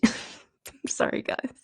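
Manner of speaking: breathy giggles through words; fighting off the giggles; giggling. Real, not acted. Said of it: "I just have the giggles".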